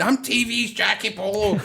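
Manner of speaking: high-pitched retold shout, no real volume; mild mock shout. Wrestler being dragged out still in character, high mock shout. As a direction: imitate a wrestler yelling his stage name. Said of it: "he was shouting".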